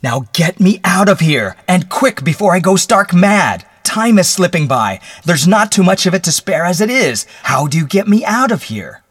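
loud, high desperate demands; near-hysterical pleading at full voice; frantic. Acted. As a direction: shout urgently to be let out. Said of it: "He gasped for breath".

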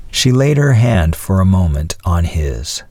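ordinary delivery

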